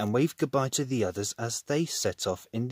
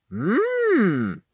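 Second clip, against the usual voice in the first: yummy mmm; 9 semitones higher, 5 dB louder than usual. A narrator's reading. Nibbling a sandwich.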